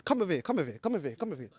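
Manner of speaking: hushed. Real, not acted. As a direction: coax them over under your breath. Calling friend away quietly.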